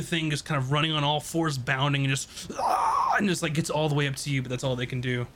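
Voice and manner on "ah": very high monster cry; sudden high-pitched screech; creature screech. Acted. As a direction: shriek like a creature bounding in. GM voices the bounding creature.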